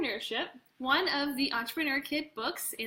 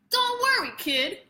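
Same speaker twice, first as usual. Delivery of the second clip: brash, higher, louder New York voice; New York accent; 10 semitones higher, 6 dB louder than usual. Acted. Producer reassuring young Jason, New York accent. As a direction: cheer him on like a fast-talking New Yorker. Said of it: "I gave him a New York accent".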